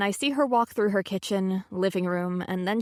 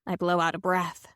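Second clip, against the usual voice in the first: ordinary delivery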